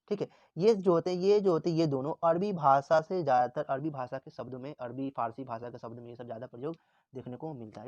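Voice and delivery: ordinary delivery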